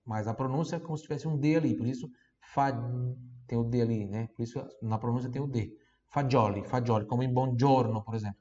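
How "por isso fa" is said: ordinary talk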